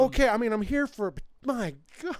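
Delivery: high-pitched, hammed-up disbelief; mock astonishment. Viewer won over by the cartoon, mock astonishment. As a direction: start loud and high, stay up there. Continues the mock stunned reaction.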